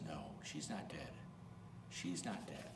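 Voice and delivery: soft and low, calmly reassuring; hushed, tender denial; gentle reassurance. Acted. Jesus comforts the mourners.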